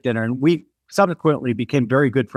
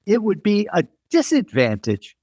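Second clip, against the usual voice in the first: lightly voiced Brit, mostly higher; British designer; 7 semitones higher than usual. British designer weighing her accent here and back home, lightly imitated. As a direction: retell her contrast in a raised voice. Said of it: "And she goes".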